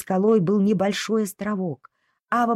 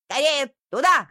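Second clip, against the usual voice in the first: high excited shout; dog shouting; 6 semitones higher than usual. Acted. Dog shouting to the crew, excited. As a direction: yell it eagerly. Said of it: "закричала собака".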